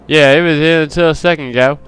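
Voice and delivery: Australian voice. Acted. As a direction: put on an Australian character voice. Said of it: "get my Australian voice".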